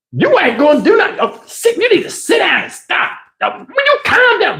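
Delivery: high, effeminate protest, rising to a squeal; high, camp plea to calm down; feminine son voice. Retold or imitated. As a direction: play the flustered feminine son. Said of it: "he's very, very, very feminine".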